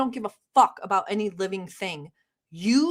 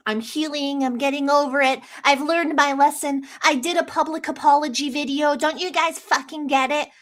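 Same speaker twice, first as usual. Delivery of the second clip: mocking quote; 5 semitones higher, 3 dB louder than usual. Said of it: "this, like, bullshit about".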